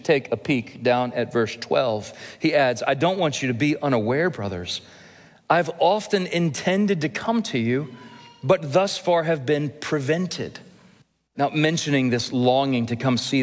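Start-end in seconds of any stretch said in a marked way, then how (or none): 2.9-10.6 raised, earnest reading of Paul's words; scripture reading. Acted. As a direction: read the verse as Paul pleading. Reads next verse from Romans.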